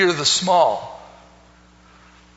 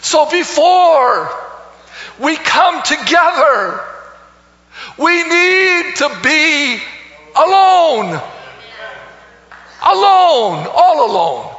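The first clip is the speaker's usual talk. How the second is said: high, loud preaching, stretched and drawn out; a preacher lifting the congregation; full-force preaching; 9 semitones higher, 5 dB louder, slower than usual. Acted. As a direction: open the sermon by calling it out to the room.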